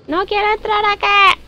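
high scared whine; reacia, asustada. Pretend. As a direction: refuse in a high, pleading whine. Se resiste a entrar, asustada. (quejido agudo de miedo)

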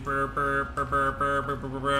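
rapid rolling burrs of a ticket printer; imitated docket machine. Shown as a demonstration. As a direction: imitate a kitchen printer chattering. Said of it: "It goes, it makes it".

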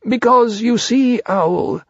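high bear voice; Pooh explaining. Acted.

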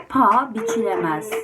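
plain reading voice

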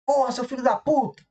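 a hammed-up road rage curse; mock angry insult. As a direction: play the furious driver cursing.

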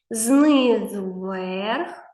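very slow, spelled-out guidance; slow dictation. Shown as a demonstration. Teacher dictating letter strokes to a child. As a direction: guide the hand slowly, word by word. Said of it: "Повторюй за мною".

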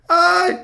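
high-pitched loud "ay"; high yelp at a near miss; startled yelp. Real, not acted. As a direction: squeal in sudden alarm. Player slips during the jumps.

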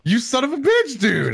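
loud, high outraged cry at a friend; shocked furious shout; outraged yell. Real, not acted. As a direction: yell it in disbelief at the betrayal. Friend drank the water he crafted.

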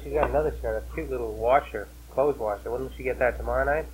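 usual speaking voice

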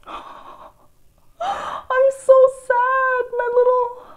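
high, loud, very slow wail; dramatic wail. Real, not acted. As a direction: ham up the despair. Gasps, bangs ruined.

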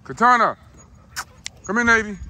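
loud high call to the dogs. Real, not acted. Calling dogs back.

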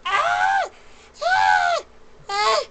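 high, loud frightened screams; panicked pleading. Real, not acted. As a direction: scream and beg for your life. Pleading while attacked.

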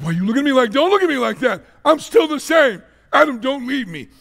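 high, loud pleading as Eve. Retold or imitated. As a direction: beg him not to leave, voice climbing. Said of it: "She's like".

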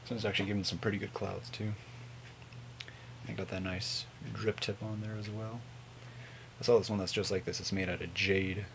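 ordinary talk

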